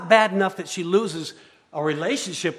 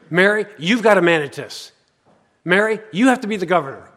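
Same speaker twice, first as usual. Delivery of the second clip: louder, pushy tempter, faster at the end; the enemy in Mary's head, insistent and pushy; whispering enemy voice; 3 semitones higher, 8 dB louder than usual. Acted. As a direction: voice the enemy pressuring Mary. Voicing the enemy inside Mary's head.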